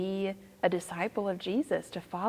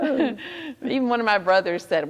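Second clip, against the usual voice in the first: loud, higher, through leftover laughter; laughing; 7 semitones higher, 7 dB louder than usual. Real, not acted. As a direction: talk while still laughing from the joke. Joking about her accent amid laughter.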